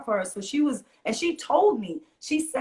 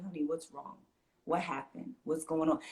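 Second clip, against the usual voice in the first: soft, low and close, much softer; 4 semitones lower, 8 dB quieter than usual. Retold or imitated. Mother at eye level soothing an upset kid, hushed and soft. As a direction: gently coax a troubled child at eye level. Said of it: "very low voice".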